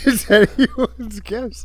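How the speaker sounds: high, slow mocking echo. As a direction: parrot it back high and slow. Repeats partner's line mockingly.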